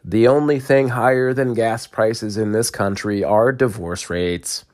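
ordinary talk, nothing marked

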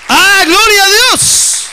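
drawn-out high, loud praise; exultant cry. Real, not acted. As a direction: cry out praise to God, slowly. Preacher's exultant outburst. (grito de júbilo)

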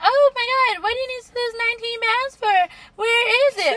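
high mock disbelief; incredulous friends. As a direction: squeal like a friend who can't believe it. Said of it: "everybody be like".

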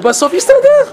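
sudden very high cry; preacher promises it will all work out, high and excited; exclaimed. Acted. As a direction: fling it up like good news.

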